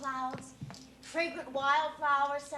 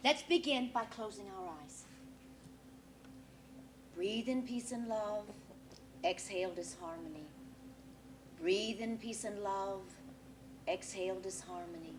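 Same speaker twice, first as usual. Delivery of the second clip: guided meditation; 5 semitones lower than usual. Acted. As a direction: lead them gently into the breath. Medium leads a breathing meditation.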